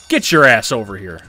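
loud, furious command; angry shout. Real, not acted. Gamer yelling at opponent Kane, angry. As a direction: bark it at him.

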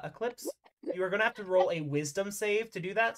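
ordinary talk, nothing marked

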